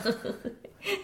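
a high, airless little laugh; breathy laugh covering her worry; breathy nervous laugh. Acted. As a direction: laugh it off under your breath. Nervous laugh hiding her feelings.

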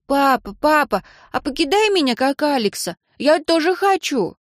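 put-on high, eager child voice; pleading little girl. A narrator's reading. Daughter asks dad to toss her like Alex, eagerly. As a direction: beg like a small daughter, pitch lifted. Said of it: "а дочь продолжала".

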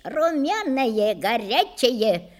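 slow, drawn-out street seller's call; vendor's cry. Acted. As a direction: stretch each word temptingly to sell it. Pie seller crying his wares.

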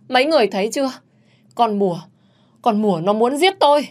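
high-pitched appeal to witnesses; acted alarmed accusation; accusing outcry. A narrator's reading. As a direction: cry murder for all to hear. Accuser cries out to bystanders.

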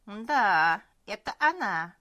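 put-on loud, booming old cook. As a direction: proclaim it like a proud old cook. Cook recognises the star.

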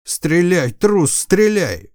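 furious order. Acted. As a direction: yell at the coward to shoot. Commander yells at coward.

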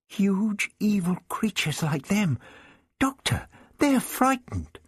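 high-pitched urgent excitement; excited realization. Acted. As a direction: call the Doctor's attention, thrilled. Vicky urges the Doctor, excited.

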